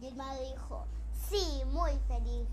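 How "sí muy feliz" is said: slow, loud, pleased mage answer; loud happy yes. Acted. Mage telling the witch he is very happy, loud and slow. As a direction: boom out how happy he is.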